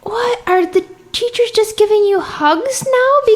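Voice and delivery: high, scandalized questioning; mock incredulity. Clarinetist retells challenging a younger player, mock-incredulous. Retold disbelief about soft teaching.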